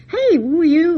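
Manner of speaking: loud and high, demanding who he is; startled sisters calling out loud; challenging call. Retold or imitated. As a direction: call out at the intruder. Ladies challenge the stranger.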